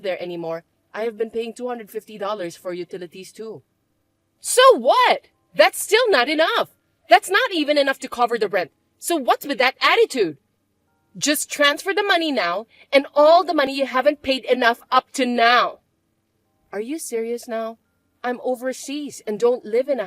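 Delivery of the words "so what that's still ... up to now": loud, scornful stepsister voice, raised pitch; a spoiled stepsister barking demands; bratty stepsister. Acted. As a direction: stepsister sneering at her older sister.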